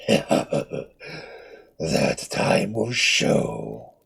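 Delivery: low, very slow, hollow ominous voice; a ghostly promise as he vanishes; mummy's threat. Acted.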